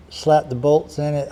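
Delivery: normal talking voice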